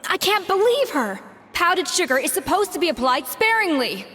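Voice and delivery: high-pitched, outraged rant; indignant outburst. Acted. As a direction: fume over a botched dish. Chef's outburst over bad cooking.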